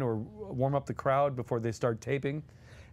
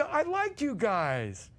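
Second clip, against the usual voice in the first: Mel Brooks impression; 6 semitones higher, much slower than usual. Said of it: "Mel says".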